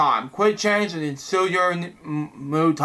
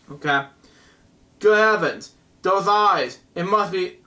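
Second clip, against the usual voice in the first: ordinary talk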